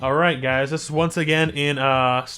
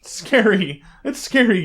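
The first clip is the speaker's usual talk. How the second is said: higher, louder scared whine; playful fright; 6 semitones higher, 6 dB louder, slower than usual. Real, not acted. Gamer telling viewers it frightens them, higher and louder. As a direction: say it like you just got spooked.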